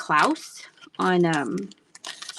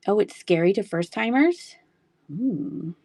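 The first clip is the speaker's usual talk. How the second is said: ordinary talk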